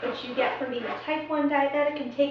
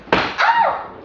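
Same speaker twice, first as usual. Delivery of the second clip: loud high yelp; surprised yelp; 7 dB louder than usual. Pretend. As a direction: yelp as things fall. Dropping things, yelp.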